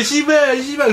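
high-pitched parroted curse; imitating Taiwanese players. Gamer repeating what Taiwanese teammates said, mocking imitation. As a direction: mimic foreigners trying out a Korean swear word. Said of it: "막 이러는 거야".

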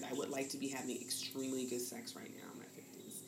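hushed, unvoiced admission; whispered confession. Real, not acted. Admitting to the host what she wants, in a whisper. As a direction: let it slip out as a secret. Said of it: "confessing desire softly".

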